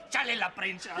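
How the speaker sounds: usual speaking voice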